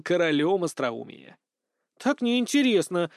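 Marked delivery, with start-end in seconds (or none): 2.0-3.1 high, slow, sulky complaint; pouting. A narrator's reading. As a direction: say it with a pout. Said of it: "надула губы Пён".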